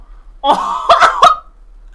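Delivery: loud, high burst of laughter; real high cackle. Real, not acted. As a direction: crack up in sudden delight. Discovers he can be a cow.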